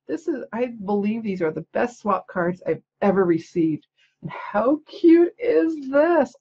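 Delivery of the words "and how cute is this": high, slow, drawn-out coo of delight; cooing "how cute", stretched out and high; gushing delight. Real, not acted. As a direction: gush over it like a cute puppy. Gushing over a cute card.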